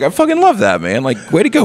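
high, gushing cheer; excited fan. Retold or imitated. Fan reacting to his favourite clip, gushing high. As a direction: squeal with delight at the clip. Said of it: "And I'm like".

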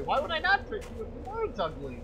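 normal talking voice

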